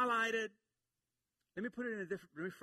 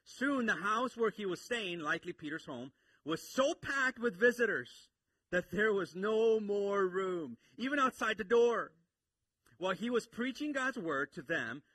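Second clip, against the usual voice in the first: louder and higher, rising preacher's fervor; full-force preaching; 6 semitones higher, 7 dB louder than usual. Acted. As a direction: preach it out to the whole room. Preacher builds the scene.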